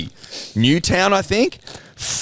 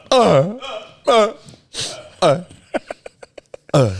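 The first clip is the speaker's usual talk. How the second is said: series of higher-pitched "uh" noises; competitive inflected grunts; 7 semitones higher than usual. Shown as a demonstration. Showing an air off with Jack, inflected grunts. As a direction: trade spaced-out grunts, each with inflection. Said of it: "We'll have an air off".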